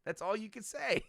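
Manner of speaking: high-pitched teasing sister; Dee Dee. Retold or imitated. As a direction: pop up with a squeaky taunt. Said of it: "Dee Dee pops her head up".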